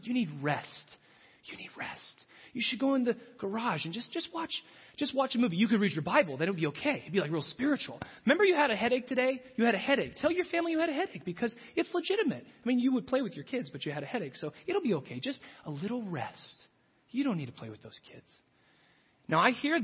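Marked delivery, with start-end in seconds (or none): 1.5-2.3 breathy tempting whisper; unvoiced coaxing whisper. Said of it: "she whispers to you". 2.5-18.3 coaxing, tempting whisper-like voice of desire; a hint of a seductive temptress. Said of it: "she whispers to you".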